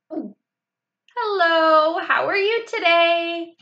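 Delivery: mildly shaky, covering fear; nervous child greeting angry mother. A narrator's reading. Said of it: "kind of shaky".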